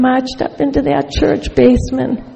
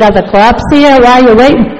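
loud, comically suspicious questions; retold paranoid alarm; 7 dB louder, faster than usual. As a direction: fire off mock-paranoid questions loudly. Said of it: "Then I got paranoid".

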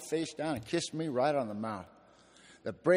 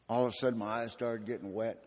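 usual speaking voice

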